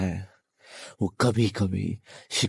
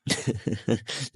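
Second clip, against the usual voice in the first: sinister chuckle; 4 dB quieter than usual. Acted. Captor tells captive she must follow his wishes, sinister chuckle. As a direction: chuckle quietly, savouring the control.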